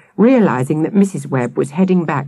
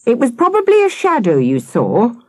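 raised, higher voice; shouted; 7 semitones higher than usual. A narrator's reading. Akela calling back to the children, mildly shouted. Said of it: "Akela shouted".

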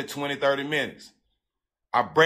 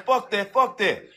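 higher, rowdy dismissal; hyped-up friend; 5 semitones higher than usual. Retold or imitated. Friend in the background waving off the trouble, hyped. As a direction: imitate a friend brushing it off.

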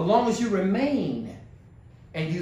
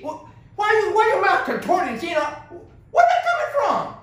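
high-pitched incredulous questioning; mock bewilderment; 11 semitones higher, 4 dB louder than usual. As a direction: act baffled, pitch leaping way up. Acts out mocking bewildered complaint.

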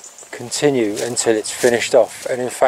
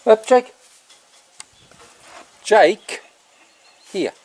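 high, raised call to the dog; 10 semitones higher, slower than usual. Real, not acted. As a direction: get the dog's attention from across the barn. Calling the dog.

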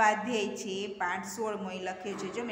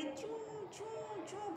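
high, drawn-out squeaky mouse imitation; mouse squeak; 11 semitones higher, 11 dB quieter, slower than usual. Shown as a demonstration. Teacher showing pupils how a mouse keeps going, squeaking imitation.

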